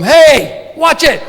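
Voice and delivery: a disguised photographer yelling from a bush; mock yell. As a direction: bark it out like a hidden man caught off guard. Bush yells at security guard.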